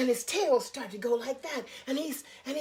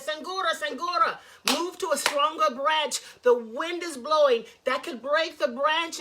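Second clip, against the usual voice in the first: raised, urgent voices calling up the tree; urgent warning call; 3 semitones higher, 7 dB louder than usual. Acted. Animals calling up to Sangura in the storm, loud and urgent. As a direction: shout a warning to him over the wind.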